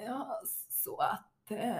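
very slow, halting mock awkwardness; stel, tafatt imitation. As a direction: drag it out softly, as if nothing to say. Mimics a tongue-tied, awkward date. (trögt, tafatt härmande)

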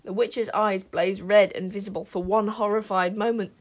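ordinary delivery, nothing marked

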